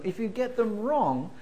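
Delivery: normal talking voice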